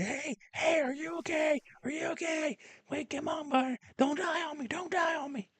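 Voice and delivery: high, desperate shout; frantic yelling. Retold or imitated. Shaking the dummy during the CPR test, panicked high yell. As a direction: beg him not to die, full volume. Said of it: "and I yell".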